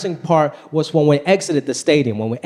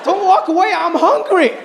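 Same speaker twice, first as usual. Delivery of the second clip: pleading son; 10 semitones higher than usual. Retold or imitated. Son pleading with dad at the hot dog stand. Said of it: "I'm like".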